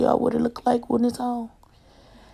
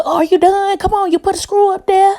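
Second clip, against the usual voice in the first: higher, louder impatient holler; re-enacted high-pitched nagging; 10 semitones higher, 5 dB louder than usual. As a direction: call up impatiently to someone mid-job. Nagging husband while helping with the ceiling.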